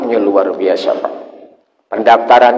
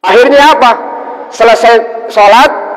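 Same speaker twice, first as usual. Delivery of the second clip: preaching at full force; 11 semitones higher, 3 dB louder than usual. Acted. Preacher asks the congregation what happened, high and loud. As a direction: stretch it out to hold the crowd. Sermon emphasis.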